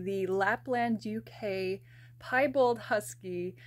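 ordinary talk, nothing marked

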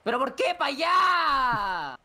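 high, slow, loud protest; exasperated wailing shout. Real, not acted. Player despairing at teammate's wrong move, wailing shout. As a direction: wail it out, stretching the last word.

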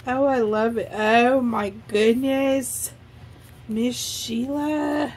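ordinary talk, nothing marked

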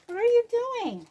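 high, loud mock scold at the cat; pet scolding. Real, not acted. Crafter scolding the cat getting into her things, high and loud. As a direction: chide the kitty in a squeaky high voice.

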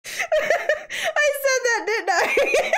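high, drawn-out words through laughter; laughing hard. Real, not acted. Realizing own slip of the tongue, laughing hard. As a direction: squeal it out as you crack up. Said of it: "I'm crying a little bit".

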